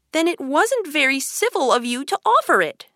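put-on high-pitched, mildly angry girl. A narrator's reading. Alice rebuking the March Hare over lemonade, mildly angry. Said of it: "said Alice angrily".

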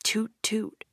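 whispered dreamy toot; sleepy whisper of a car horn; murmured. A narrator's reading. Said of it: "he murmured".

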